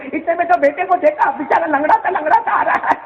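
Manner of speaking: high, fervent storytelling; impassioned preaching. Acted. Preacher tells the crowd how the mother ran to her bleeding son, fervent. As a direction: build the mother's rush toward her son.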